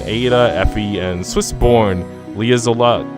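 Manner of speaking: ordinary talk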